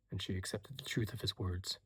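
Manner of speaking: ordinary delivery, nothing marked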